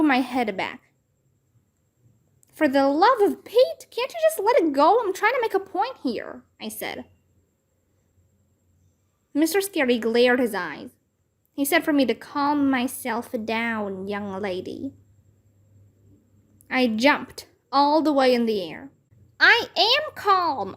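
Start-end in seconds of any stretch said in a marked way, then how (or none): 2.5-6.4 high, fast exasperated plea; exasperated outburst. Acted. Girl losing patience with her teacher, high and fast. 19.4-20.7 high, slow holler. A narrator's reading. Girl hollering at teacher that she is calm. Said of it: "I hollered back".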